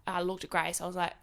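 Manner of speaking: usual speaking voice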